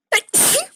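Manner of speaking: loud sudden sneeze; sneezing. Real, not acted. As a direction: sneeze hard, high and loud. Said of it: "I sneezed".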